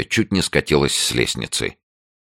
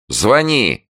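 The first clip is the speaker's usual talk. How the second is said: defiant, raised voice; loud agreement; 10 semitones higher, 4 dB louder than usual. A narrator's reading. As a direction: dare them, high and loud. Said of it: "согласилась Таня громко". (громко, с вызовом)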